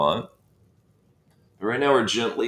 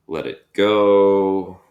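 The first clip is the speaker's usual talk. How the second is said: long, loud, sustained release; drawn-out exhale cue; 7 dB louder, much slower than usual. Real, not acted. Instructor cueing the class to breathe out, drawn out and loud. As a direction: stretch it out slowly and louder.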